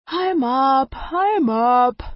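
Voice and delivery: high, yawning reply. Acted. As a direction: say it through a yawn. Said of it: "I yawned".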